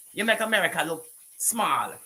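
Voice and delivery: usual speaking voice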